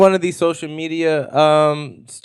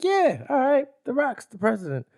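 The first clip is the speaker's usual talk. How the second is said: much higher, soft voice; high resigned acceptance; 11 semitones higher, 7 dB quieter than usual. Retold or imitated. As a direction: imitate someone accepting it, high and light. Imagined reaction to the news.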